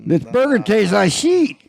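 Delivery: slow, high drawled joke; a hammy country complaint; mock country voice. Joking about the burger's taste, in a slow high mock country voice. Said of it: "we say".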